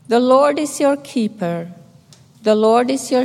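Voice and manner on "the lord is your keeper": ordinary talk, nothing marked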